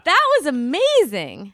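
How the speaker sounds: loud, slow, delighted exclaim. Real, not acted. Wife gushes to husband about the toddler, loud and delighted. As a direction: gush over it, still laughing.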